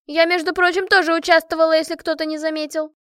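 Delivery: high-pitched, loud indignant protest; jealous yelling. A narrator's reading. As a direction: loudly insist on getting your share of credit. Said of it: "с ревностью заорала Машка".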